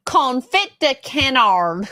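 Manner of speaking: broad American "confit de canard", hard T in confit; American version. Shown as a demonstration. Co-host demonstrates own American accent on "confit de canard". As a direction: say "canard" as can-ARR, American. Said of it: "my American would be".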